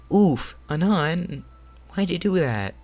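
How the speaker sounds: high, loud startled outburst; alarmed cry. Acted. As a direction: cry out in shock at someone getting cut. Acted reaction to cut finger.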